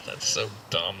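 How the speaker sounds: normal talking voice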